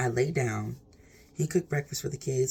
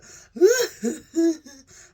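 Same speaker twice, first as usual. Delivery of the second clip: sudden laugh; 8 dB louder than usual. Real, not acted. Laughs at her boo-hoo description.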